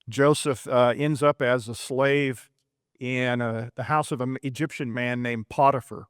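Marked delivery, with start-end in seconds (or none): none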